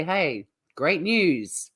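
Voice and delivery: ordinary talk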